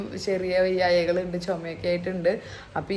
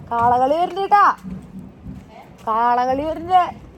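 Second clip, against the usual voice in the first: higher and louder, calling out to husband; thrilled the bull procession is coming; excited call; 6 semitones higher, 7 dB louder than usual. Real, not acted. Festival procession arriving, calling out.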